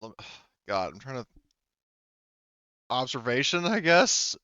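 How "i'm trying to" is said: ordinary talk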